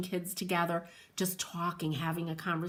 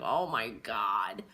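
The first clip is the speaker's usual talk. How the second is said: much lower, slow drawn-out dismay; mock shock; 12 semitones lower, 3 dB quieter, slower than usual. Mother remembering her sons' remarks, low slow mock dismay. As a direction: groan it like an appalled parent. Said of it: "and it's like".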